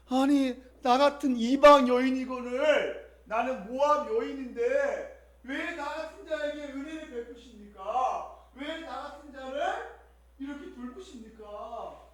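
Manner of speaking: 높고 점점 잦아드는 울먹임; 흐느끼듯 낮아지는 고백; Ruth weeping. Retold or imitated. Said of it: "울면서 아마 얘기한 것 같아요". (울먹이며 점점 작아지는 룻의 고백)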